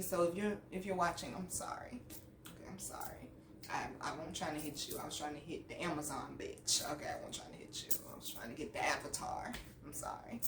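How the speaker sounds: low murmur sinking into a whisper; small, sheepish sorry under the breath; hushed apology. Real, not acted. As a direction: apologize very softly, almost voiceless. Apologizing to the teacher on camera.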